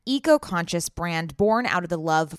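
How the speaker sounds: loud, emphatic brand introduction; ad read. Real, not acted. Co-host introducing Whimsy and Row, loud ad read. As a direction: announce the brand with big stretched emphasis. Reads a clothing ad.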